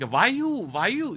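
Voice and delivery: high, slow mock Indian accent; imitated Indian accent. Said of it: "the Indian guy is like".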